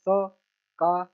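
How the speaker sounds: very slow, each syllable held apart. Shown as a demonstration. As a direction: sound it out piece by piece for a learner. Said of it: "छोटा सा letter पढ़ लेना".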